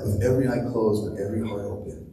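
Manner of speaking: a soft, hushed youth-pastor plea; hushed altar-call voice. Retold or imitated. Youth pastor to a darkened room, a soft hushed plea. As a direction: coax them gently toward the altar call, hushed. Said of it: "they'd say words with this tone of voice".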